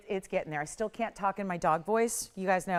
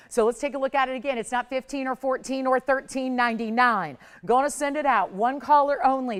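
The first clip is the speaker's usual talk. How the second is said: louder, higher and faster, hyping the deal; urgent sales pitch, one caller only; excited sales push; 5 semitones higher, 6 dB louder than usual. Real, not acted. Pushing a blowout price on air.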